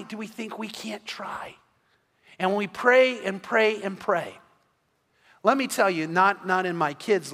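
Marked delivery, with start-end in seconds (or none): 0.0-1.5 whisper. Real, not acted. Preacher drops to a whisper.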